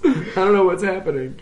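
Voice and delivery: usual speaking voice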